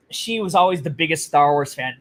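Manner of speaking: normal talking voice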